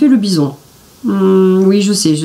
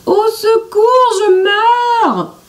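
much higher, slow drawn-out cry for help; fake wounded moaning; much slower than usual. A narrator's reading. Jean-Claude faking injury to attract the bison. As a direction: wail as if hurt, to lure the bison. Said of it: "se met à gémir comme s'il était blessé".

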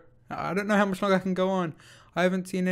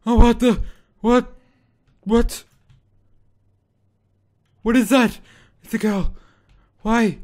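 high, loud panicked yelps; frightened screaming; 6 semitones higher, 14 dB louder than usual. Real, not acted. Player ambushed by a jump scare, screaming in fright. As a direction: shriek in real terror.

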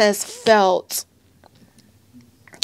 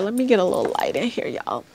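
ordinary talk

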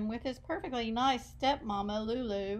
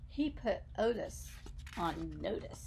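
low menacing hush; 4 semitones lower, 6 dB quieter than usual. A narrator's reading. Menacing close of the scene.

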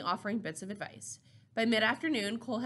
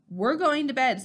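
louder, higher, decisive dismissal; firm refusal; 5 semitones higher, 7 dB louder than usual. A narrator's reading. Elder answers teen asking about the dance, firm refusal. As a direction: shut the question down.

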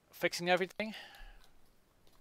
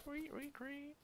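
cricket chirps; 11 dB quieter, slower than usual. Real, not acted. Imitates noise while editing.

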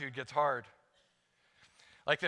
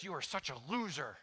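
self-insult; 8 dB quieter than usual. Retold or imitated. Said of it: "I, I, I say this".

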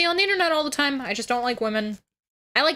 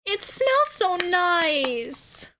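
higher, very slow put-on voice; voice impression; 7 semitones higher, much slower than usual. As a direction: stretch it out in a dreamy put-on voice. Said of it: "That was a very good voice impression there".

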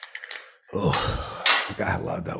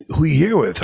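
mock bouncer; 7 semitones higher, 10 dB louder than usual. Bouncer questioning.

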